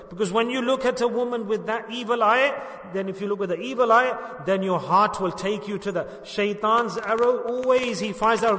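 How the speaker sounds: impassioned preacher; fervent preaching. Acted. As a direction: warn the congregation with force. Preaches on fighting desires.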